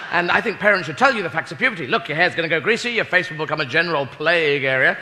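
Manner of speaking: usual speaking voice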